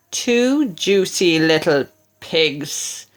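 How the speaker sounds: low, slow savouring wolf; lightly voiced greedy wolf. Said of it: "the wolf cried".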